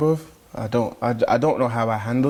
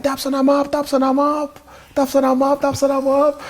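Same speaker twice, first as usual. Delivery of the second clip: high, louder mock girl's call; imitating a fan; 13 semitones higher, 4 dB louder than usual. Mimicking a Spanish fan calling his name, high excited call. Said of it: "She's like".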